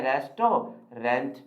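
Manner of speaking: "रेस्टोरेंट" in three parts, res-to-rent; common mispronunciation. Shown as a demonstration. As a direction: sound a full "to" and "rent" in "रेस्टोरेंट". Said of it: "कैसे पढ़ते हैं हम इसे?". ("रेस्टोरेंट" को रेस-टो-रेंट बोलें)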